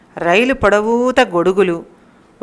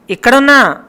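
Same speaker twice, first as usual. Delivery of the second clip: loud, high shout from the train; 5 semitones higher, 8 dB louder than usual. A narrator's reading. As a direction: yell so he hears you. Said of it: "కేకేసింది". (రైలులోంచి భర్తకు గట్టి కేక)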